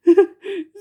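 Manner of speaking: high, loud giggling burst; high giggle. Acted. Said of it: "The king looked at her".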